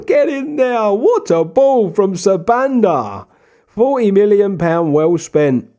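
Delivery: real delight at the goal; goal celebration. Real, not acted. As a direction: cheer the goal.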